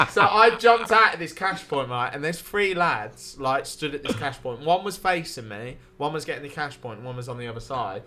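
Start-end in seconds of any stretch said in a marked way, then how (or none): none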